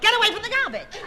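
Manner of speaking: high, loud scolding mother; exasperated yell. Acted. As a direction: yell at the kids across the room. Mother scolds children, losing patience.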